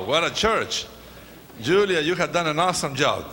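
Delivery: ordinary delivery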